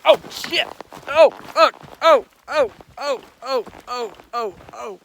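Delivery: pained yelps, high and loud, then fading; stubbed toe. Real, not acted. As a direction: yelp from a stubbed toe, dying down.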